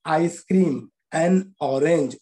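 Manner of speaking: slow, careful article and noun; slow demo reading. Shown as a demonstration. Teacher reading slowly to students. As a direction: spell it out slowly for the class. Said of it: "एन बोल रहे हैं".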